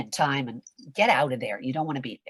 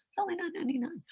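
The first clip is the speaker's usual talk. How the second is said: higher, soft, sing-song commercial voice; fake announcer read; 12 semitones higher, 8 dB quieter, much faster than usual. Shown as a demonstration. Coach mocking a fake commercial read. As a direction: sell it like a fake TV ad. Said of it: "Who is that person? That's not a real person".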